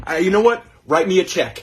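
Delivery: eager robber. Acted. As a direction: blurt the idea out.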